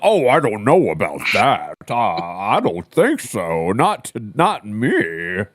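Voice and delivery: lightly voiced awkward man backing off; mock hesitant reply. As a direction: drag out a flustered no.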